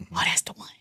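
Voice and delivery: very soft awed whisper; hushed realization. Retold or imitated. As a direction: whisper it to yourself as it clicks. Retelling the moment the name came.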